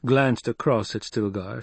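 plain reading voice

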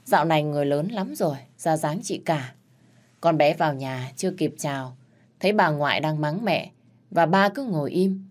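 ordinary delivery

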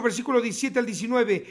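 ordinary talk

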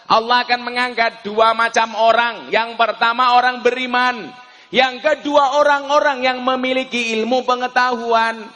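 sustained, forceful translation of scripture; intoned sermon proclamation; declamatory preaching. Real, not acted. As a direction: proclaim it steadily to the crowd.

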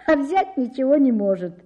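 plain reading voice

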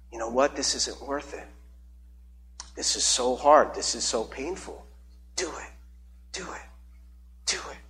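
hushed, dark urging that fades almost to nothing; a sinister voice coaxing someone toward death; tempting inner voice. Acted. Voicing a spirit urging suicide.

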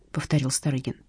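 ordinary delivery

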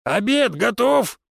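high, stretched-out shouted question; calling out. A narrator's reading. Husband asks his wife whether lunch is ready, calling out. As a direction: call slowly through the house. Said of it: "громко прокричал Финн".

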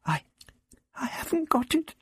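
high, halting stammer. A narrator's reading. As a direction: stumble over it in a high, anxious voice. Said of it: "she stammered".

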